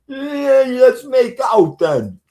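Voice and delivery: high, loud hammed-up whoop; playful mock yell; mock come-on. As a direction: ham up a joking pass at him. Joking come-on to a friend.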